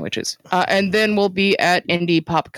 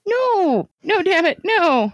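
mock anguished protest; 11 semitones higher, slower than usual. Mock protest at being locked up.

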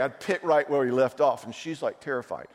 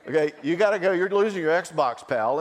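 put-on louder, firm parent to kid; stern parent; 6 semitones higher, 6 dB louder than usual. Parent telling a six-year-old he loses his Xbox, lightly firm and louder. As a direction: lay down the rule.

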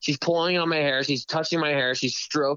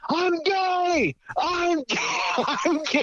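high, hammed-up yell with a laugh; mock yelling; much slower than usual. Retelling his yell at the bar, high mock shout. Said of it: "I started yelling".

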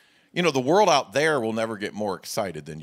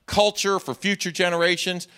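higher, louder earnest pledge; eager churchgoer; 7 semitones higher, 5 dB louder than usual. Retold or imitated. Preacher voicing an eager member pledging to David, raised and earnest. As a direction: voice a keen member promising to help. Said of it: "You say".

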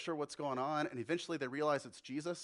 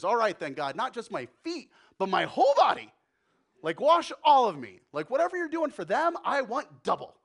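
loud, high, eager all-in request; eager Peter; 5 semitones higher, 11 dB louder than usual. Acted. Peter answers Jesus, suddenly all in, loud and eager. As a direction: Peter begging for more, voice climbing.

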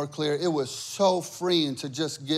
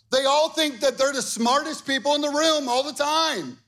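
higher, louder preacher's drive; fired-up preaching; 9 semitones higher, 4 dB louder than usual. Acted. Pastor rousing his congregation, fired-up preaching. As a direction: preach it to the back row.

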